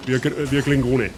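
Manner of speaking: usual speaking voice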